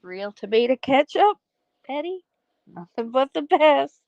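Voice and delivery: high, proud hostess voice; quoting Catherine. Retold or imitated. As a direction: show off the ketchup. Quoting the movie dinner scene.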